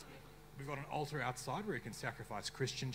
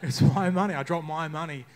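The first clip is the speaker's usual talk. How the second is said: louder, exasperated insistence; indignant protest; 6 semitones higher, 13 dB louder than usual. Acted. Comic ranting to the crowd about dropped money, loud and indignant. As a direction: insist it's your money, raised and fed up.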